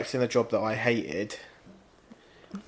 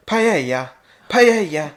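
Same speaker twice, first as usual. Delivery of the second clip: "paella" repeated, rising higher and louder, hammed-up Spanish; mock a TV cook drilling "paella" at guests; 6 semitones higher, 5 dB louder, slower than usual. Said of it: "how to say paella".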